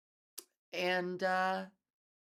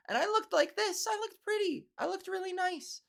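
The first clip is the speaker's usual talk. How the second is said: high, sweet feminine voice; best girly voice; 12 semitones higher than usual. Retold or imitated. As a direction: play up a pretty, dainty voice. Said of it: "in my best voice".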